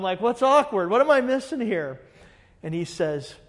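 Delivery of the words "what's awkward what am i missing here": higher, louder baffled retort; retold bewilderment, pitched up and punchy; baffled questioning. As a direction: act out not getting it. Retelling his baffled reply to dad.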